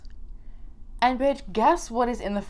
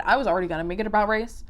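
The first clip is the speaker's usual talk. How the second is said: usual speaking voice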